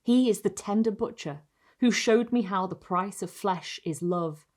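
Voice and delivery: ordinary delivery, nothing marked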